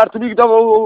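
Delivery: ordinary talk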